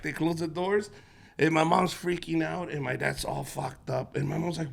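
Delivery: normal talking voice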